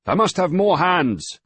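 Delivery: loud, high shout over the wind. A narrator's reading. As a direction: yell it into his ear against the storm. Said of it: "the sailing master shouted almost into Griffith's ear".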